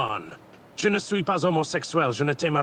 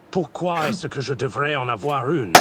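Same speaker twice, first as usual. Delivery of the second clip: villain roleplay voice; 8 dB louder than usual. Acted. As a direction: grandiose villain speech, held steady. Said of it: "un truc dans la gorge".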